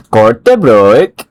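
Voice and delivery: "Kortebrook" drawn out slowly with a rising end, in a mock Swedish-like accent. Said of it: "you guys talk similar to Swedish people".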